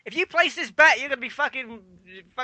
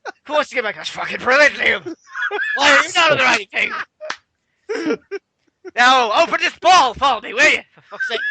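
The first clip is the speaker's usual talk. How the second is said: mock Irish accent, pitched higher; a hammed-up Irish accent for the boss; [name] in Irish accent; 7 semitones higher, 5 dB louder than usual. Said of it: "Was that your Irish impression?".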